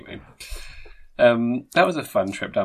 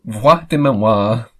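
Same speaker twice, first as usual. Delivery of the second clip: hammed-up French pronunciation; amazing French accent; 7 dB louder, much slower than usual. Reading a translation aloud to co-host, showy French accent. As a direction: show off a slow, amateur French accent. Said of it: "Is it my amazing accent?".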